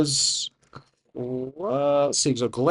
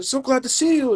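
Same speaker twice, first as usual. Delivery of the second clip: high, gushing welcome; acted happy reunion voice; overjoyed greeting; 12 semitones higher, 3 dB louder than usual. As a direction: greet a loved one with delight, pitched way up. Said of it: "she starts to tear up".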